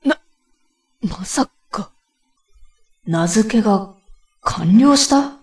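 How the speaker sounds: rising to a high, loud gasp; stunned disbelief; shocked. Acted. Naming unexpectedly binds him.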